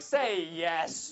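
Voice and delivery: loud call to the room; rally the audience to answer. Real, not acted. Speaker to seminar audience, loud call for a yes.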